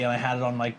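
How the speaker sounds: ordinary talk, nothing marked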